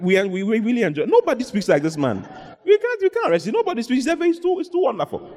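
excited officers, pitch climbing high; awestruck, happy. Acted. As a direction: gush in mounting, high-pitched amazement. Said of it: "They were so happy".

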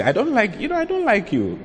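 squeaky high, coy imitation of a woman refusing; mocking girly voice. Shown as a demonstration. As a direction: mimic a timid woman's weak no. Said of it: "As you say".

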